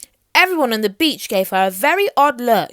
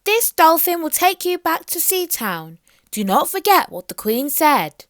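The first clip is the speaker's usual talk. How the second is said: warning; 7 semitones higher than usual. Acted. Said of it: "warned the mermaid".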